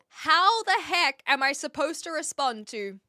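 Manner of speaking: exasperated rant. Real, not acted. Ranting about British greeting.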